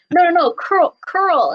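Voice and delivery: light imitation of a Scottish accent, correcting; Scottish instructor. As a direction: insist on "curl" like a Scottish dance teacher. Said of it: "really heavy Scottish accent".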